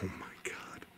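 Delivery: awed whisper. Real, not acted. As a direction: whisper it under your breath in awe. Hushed reaction to a find.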